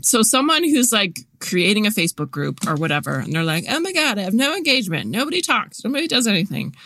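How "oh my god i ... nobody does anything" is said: high-pitched mock whining; whiny voice. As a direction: whine it as an exaggerated complaint. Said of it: "I say it in that whiny voice".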